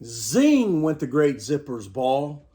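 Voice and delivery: ordinary delivery, nothing marked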